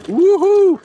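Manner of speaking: high joyful whoop; excited whoop. Real, not acted. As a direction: let out a big happy whoop. Whooping while riding off-road.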